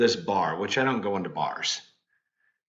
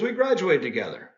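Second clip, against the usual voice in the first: higher, lightly voiced classmate; a touch of the chirpy schoolmate, pitched up; mildly raised friendly classmate voice; imitating old classmate Jeff; 7 semitones higher than usual. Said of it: "He goes".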